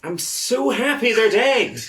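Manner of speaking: doting. Acted. Reassuring pet dog.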